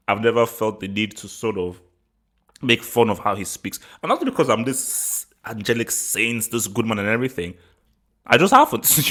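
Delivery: ordinary talk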